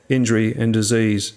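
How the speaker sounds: usual speaking voice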